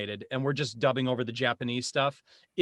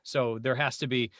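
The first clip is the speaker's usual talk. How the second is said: usual speaking voice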